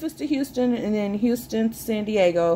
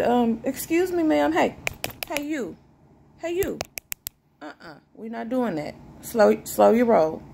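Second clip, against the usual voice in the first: high sassy stomach voice; a sassy voice for her stomach; stomach talking back; 4 semitones higher than usual. Retold or imitated. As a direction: play your stomach scolding you, sassy and high. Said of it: "and LaFonda said".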